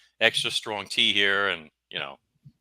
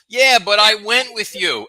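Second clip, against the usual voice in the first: much higher, loud and slow mimicked girl; insistent girl; 12 semitones higher, 8 dB louder, slower than usual. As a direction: insist on it like a stubborn teenage girl. Retelling girl's insistence.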